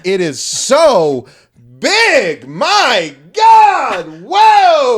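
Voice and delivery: building from a slow stretched "so" to a loud high "whoa"; hammed-up awe, rising to a yell; mock amazed shout. Mocking his friend's awe at the towel drop, loud and high. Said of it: "you were like".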